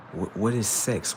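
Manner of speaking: usual speaking voice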